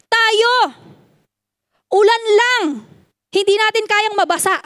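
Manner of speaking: fiery preacher rebuke; full-force preaching. Acted. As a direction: scold the church for small excuses.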